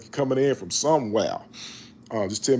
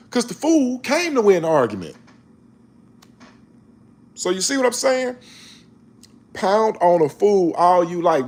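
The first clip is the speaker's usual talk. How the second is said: higher, louder preaching that keeps building; 8 semitones higher, 4 dB louder than usual. Acted. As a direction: preach it, driving harder each line. Preaching, building to full force.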